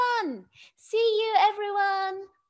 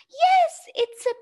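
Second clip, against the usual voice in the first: excited yes; 11 semitones higher, 5 dB louder than usual. Real, not acted. Excited reveal of panda hat.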